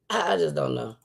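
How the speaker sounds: ordinary talk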